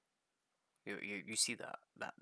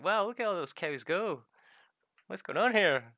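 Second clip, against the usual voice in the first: mock surprise; 8 semitones higher, 9 dB louder than usual. Acts onlookers puzzled by stampede.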